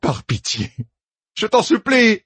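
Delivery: plea climbing to a high cry; desperate begging. Acted. Captive begs the torturer for mercy, desperately imploring. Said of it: "Implore-moi".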